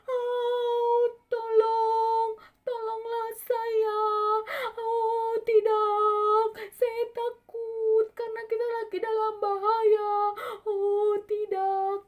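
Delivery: high, loud wailing cry for help; hammed-up sing-song panic; panicked disciple. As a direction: wail in terror, stretching each word. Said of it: "dia peluk tiang perahu, dia katakan".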